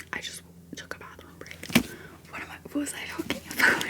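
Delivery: ordinary delivery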